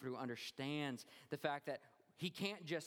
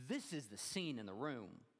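ordinary talk, nothing marked

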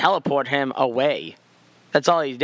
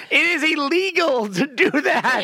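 high, drawn-out mock outrage; hammed-up lawyer's protest, much higher; outraged objection; 11 semitones higher, slower than usual. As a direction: object slowly and high, half laughing. Voices the lawyer's objection, laughing.

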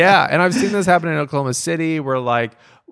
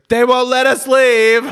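put-on high, louder whiny drunk voice; the tipsy woman tells the comics by the door, in a high mock wail; mock tipsy complaint; 11 semitones higher, 4 dB louder than usual. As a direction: play the tipsy lady wailing she's trapped. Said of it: "she goes".

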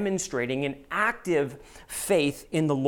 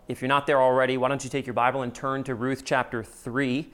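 ordinary talk

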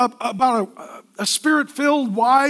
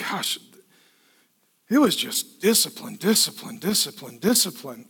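weary complaint; 8 semitones lower, 5 dB quieter than usual. Retold or imitated. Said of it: "Some of you are like".

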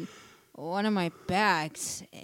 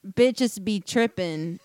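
slow, mocking delivery of the quote; 6 dB louder, slower than usual. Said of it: "and it says".

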